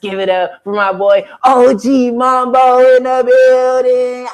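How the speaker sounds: loud, high hype-man call to the crowd; hype intro. Real, not acted. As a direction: bring the guest on like a club MC. Host hyping up the guest.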